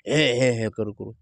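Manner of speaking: dog noises trailing into a low grumble; imitated dog cries. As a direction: copy the dog's repeated cries, pitched well down. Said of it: "तरी असं ओरडा लागलं".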